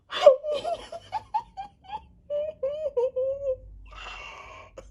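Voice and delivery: long, high, loud laughing wail; near-tears squeal. Real, not acted. Fan pulling the first photo card, tearful squeal. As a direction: squeal and cry-laugh at once. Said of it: "I'm literally about to start crying".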